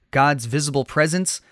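usual speaking voice

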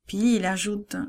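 ordinary delivery

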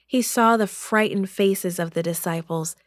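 usual speaking voice

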